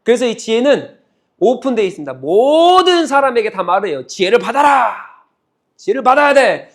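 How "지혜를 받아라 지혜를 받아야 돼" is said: loud, high proclamation; preacher crying out loud and high; shouting. Retold or imitated. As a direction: shout it out to the whole crowd. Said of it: "소리치면서".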